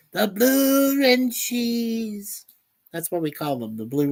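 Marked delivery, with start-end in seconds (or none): 0.1-2.5 high drawn-out. Pretend. Reading out a chat nickname.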